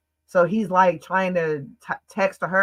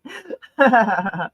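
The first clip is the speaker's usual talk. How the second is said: high, loud burst of laughter; amused high giggle; real laugh; 5 semitones higher, 5 dB louder than usual. Real, not acted. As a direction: laugh out loud at your own aside. Laughs at own aside to viewers.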